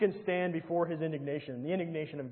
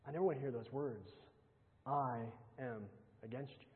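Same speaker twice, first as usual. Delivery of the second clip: hushed dread; 4 semitones lower, 10 dB quieter than usual. Real, not acted. Preacher dreads God's words.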